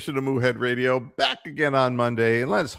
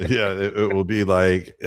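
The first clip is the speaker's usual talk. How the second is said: normal talking voice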